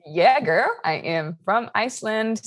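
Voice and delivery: ordinary talk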